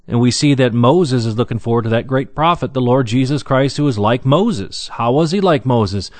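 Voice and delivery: ordinary talk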